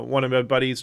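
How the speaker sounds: usual speaking voice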